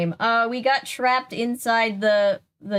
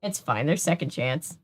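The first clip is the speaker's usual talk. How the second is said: ordinary talk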